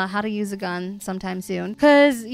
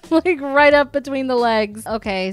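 normal talking voice

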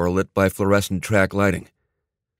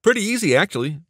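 high-pitched, breezy inventor voice; upbeat tech whiz; 8 semitones higher than usual. Acted. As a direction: show off your gadget, pitched up and casual. Voices Neil's upbeat reply.

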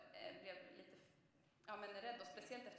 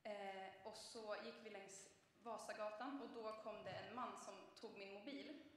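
ordinary talk, nothing marked

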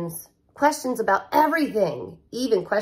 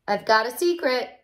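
plain reading voice

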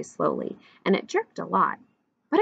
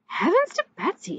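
high, startled exclamation; exclaiming; 13 semitones higher than usual. A narrator's reading. Waitress noticing Cat on the tractor, exclaiming. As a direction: jump up in pitch with surprise. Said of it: "she exclaimed".

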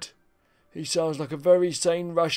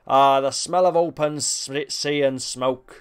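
hammed-up pirate accent, louder, drawn out slow; 5 dB louder, slower than usual. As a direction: savour the sea air like an old pirate accent. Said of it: "That's my best pirate accent".

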